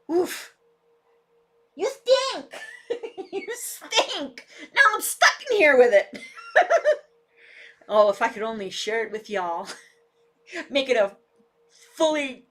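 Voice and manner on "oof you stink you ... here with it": much higher, laughing complaint; laughing disgust. Real, not acted. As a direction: laugh through the stink. Dog smells, laughing.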